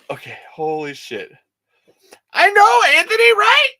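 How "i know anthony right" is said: very high, loud shriek of agreement; shrieking with excitement; ecstatic squeal. Real, not acted. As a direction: squeal it at full volume. Gushing to Anthony.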